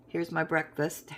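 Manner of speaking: usual speaking voice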